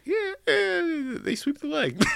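high fake-knowing voice; mock bluff answer. Mimicking a bluffing friend, high mock know-it-all. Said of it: "I'm like".

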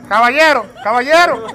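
calling out. Shown as a demonstration. Host demonstrates calling out caballero to the room. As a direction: call out caballero like hailing a crowd. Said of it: "¿Cómo se hace?".

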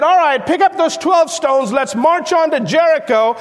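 rally the troops like Captain Joshua; commanding captain. Acted. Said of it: "Captain Joshua".

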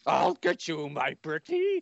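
a hint of a cackling witch; Wicked Witch. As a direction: menace it like the witch. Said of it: "There's no place like home".

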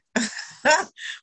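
high wheezy laugh, short of air; gasping laugh that can't stop; breathless laugh. Real, not acted. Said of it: "heard somebody who can't breathe laugh".

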